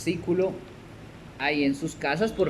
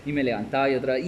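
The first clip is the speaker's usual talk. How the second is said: ordinary talk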